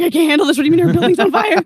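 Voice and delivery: mock panic. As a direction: blurt it out in flustered, half-amused disbelief. Drinking, hears her building is burning.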